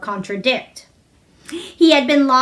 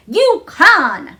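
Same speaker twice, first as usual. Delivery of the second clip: loud, higher firm shout; 5 semitones higher, 6 dB louder than usual. A narrator's reading. Mary shouting back at the sobbing boy. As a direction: shout the contradiction. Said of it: "shouted Mary".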